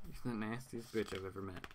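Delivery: quiet amused repeat. Retold or imitated. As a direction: mumble it back to yourself, amused. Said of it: "Green Arrow does the only cussing".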